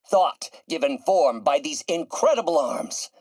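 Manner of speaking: high-pitched, ringing pride; impassioned boast; grandiose exultation. Acted. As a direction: proclaim it like a mad inventor. Exults in his invention.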